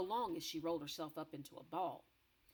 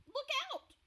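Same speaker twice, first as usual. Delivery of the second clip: much higher, urgent warning; panicked warning cry; alarmed cry; 5 dB louder than usual. Acted. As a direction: cry out in fright. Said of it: "cried the B F G".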